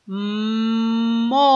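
"mo" with a long hummed m gliding into o; phonics-style stretched "mmmo"; joining two sounds. Shown as a demonstration. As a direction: hold the m of "mo" before the vowel. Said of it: "si juntamos los dos sonidos".

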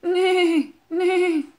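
high whinnying neigh; horse neigh. Pretend. And what does a horse say?.

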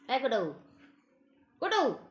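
high, loud call to a pet; sing-song pet call; calling the cat. Real, not acted. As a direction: call out to the cat across the room.

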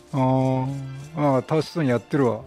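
ordinary delivery

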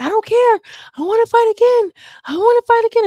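high, loud imitation of a stubborn fighter; mock insistent yell. Beaten Mariah to the crew, a high mock yell. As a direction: squeal it like she refuses to quit.

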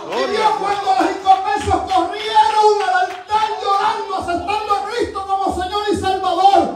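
high, driving Pentecostal delivery; full-force preaching. Real, not acted. Preacher to congregation on converts at the altar, at full force. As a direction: preach it hard, crying out the question.

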